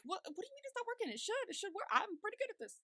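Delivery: high-pitched put-on bafflement; mock incredulous protest. Acting Amanita's indignant reply to guard.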